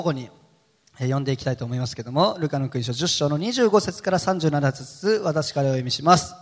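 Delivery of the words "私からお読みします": ordinary talk, nothing marked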